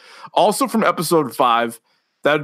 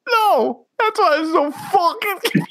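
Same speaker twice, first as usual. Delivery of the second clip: high, squeaky laughing through the words; helpless laughter. Real, not acted. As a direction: keep losing it mid-sentence, real giggles. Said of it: "how fucking hard you're laughing right now".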